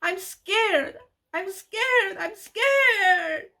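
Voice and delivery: high, tearful cries of fear; scared sobbing. Retold or imitated. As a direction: wail it through tears like a frightened girl. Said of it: "tears spilling down my cheeks".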